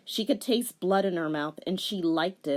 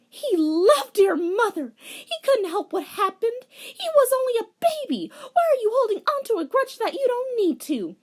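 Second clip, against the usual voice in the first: put-on high girlish voice, louder, pleading; 6 dB louder than usual. Rei pleads with villain.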